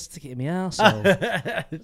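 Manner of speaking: slow, laughing punchline; laughing joke. Acted. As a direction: drag it out through a laugh. Joking mishearing of archives.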